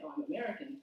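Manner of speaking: ordinary talk, nothing marked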